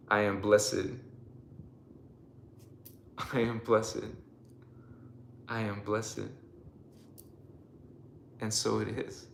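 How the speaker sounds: low, quiet affirmation with long pauses; leading affirmations. Acted. Leading viewers in a blessing, low measured affirmation. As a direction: settle softly into each repeat, leaving room to echo.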